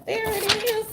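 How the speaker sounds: high, light put-on voice; a hammed-up smug reply, pitched high; mock Brian Stelter. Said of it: "Brian's like".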